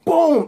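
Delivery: high, loud "boom" sound effect; booming impact. Retold or imitated. Retelling Spider-Man hitting the guy, loud high boom. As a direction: punch out a sudden loud boom.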